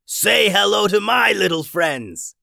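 loud, high, drawn-out gloating shout; villainous yell. A narrator's reading. Pirate captain unleashes his tentacled allies, gloating yell. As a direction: yell it slowly as a triumphant villain. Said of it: "yelled the captain as he hacked".

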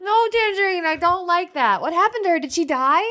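high, plaintive dismay; distressed protest. Acted. Princess asking Tangerine about the cursed girl, dismayed. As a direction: plead in pained, high-pitched disapproval.

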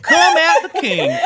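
high, loud theatrical cry; hammed-up high character voice; in-character catchphrase.

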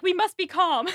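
laughing imitation of flustered composure; flustered mother. Mrs. Dashwood as a man is coming, flustered insistence. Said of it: "Missus Dashwood is like".

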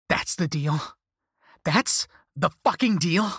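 angry yelling. A narrator's reading. Cursed person to the caller who shrugged it off, yelling. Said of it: "I was yelling now".

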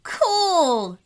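excited shout. A narrator's reading. Boy admires his cyborg brother, mild excited shout. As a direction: call it out in admiration. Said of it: "shouted Rami".

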